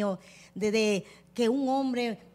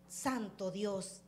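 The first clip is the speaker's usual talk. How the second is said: very soft, low and slow; hushed dismay; 6 semitones lower, 13 dB quieter, much slower than usual. Real, not acted. Panelist lamenting endless reports of killings, hushed dismay. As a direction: let it sink down, drawn out and quiet. Lamenting repeated news of femicides.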